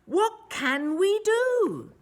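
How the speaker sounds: high, slow pleading cry. A narrator's reading. Villagers plead to the mayor, in a high cry. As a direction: villagers crying out in despair. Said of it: "The villagers cried".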